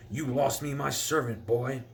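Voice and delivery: furious nobleman lunging; angry shout. Acted.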